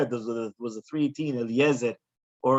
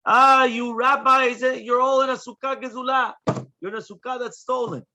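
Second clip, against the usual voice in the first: put-on old woman screaming; 10 semitones higher, 5 dB louder than usual. Teacher voices the old woman scolding the rabbis, high and loud. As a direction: screech it like an angry grandmother. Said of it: "she starts screaming".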